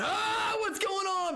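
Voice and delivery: high shouted startled wake-up; startled waking. Retold or imitated. Recalling the night he was woken, startled high shout. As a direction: jolt awake with a high yelp. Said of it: "I would love just jump in".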